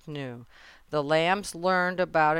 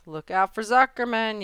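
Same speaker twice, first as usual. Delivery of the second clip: higher, louder warning; urgent raised call; yelling; 6 semitones higher, 5 dB louder than usual. A narrator's reading. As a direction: yell a warning like an alarmed gander. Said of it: "yelled the gander".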